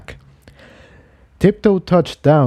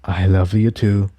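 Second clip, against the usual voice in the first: said breathily; 3 semitones lower than usual. Acted. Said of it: "Jigsaw said breathily".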